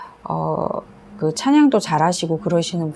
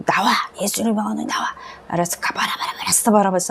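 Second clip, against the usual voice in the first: exorcism and tongues; 3 semitones higher, faster than usual. Retold or imitated. Exorcism command then tongues.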